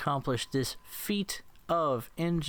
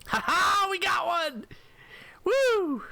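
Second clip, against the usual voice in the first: high, loud cheer of victory; elated yell after scoring a hit; triumphant whoop; 4 dB louder, slower than usual. Real, not acted. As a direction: crow it out at a much higher pitch. Celebrates a kill in game.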